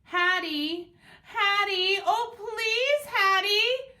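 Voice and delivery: high, loud whining begging; whining plea. A narrator's reading. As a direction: whine her name over and over, pleading. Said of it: "I whine some more".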